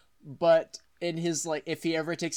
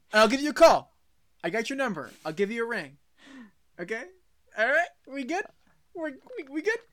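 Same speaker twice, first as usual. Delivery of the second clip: mock reassurance, higher and higher; awkward brush-off; 8 semitones higher, faster than usual. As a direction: voice climbing high as they back away. Dream backing out of talk with Mom.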